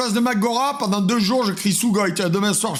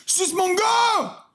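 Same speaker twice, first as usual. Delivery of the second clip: excited shout; 9 semitones higher, much slower than usual. Acted. Mock translation joke, shouted.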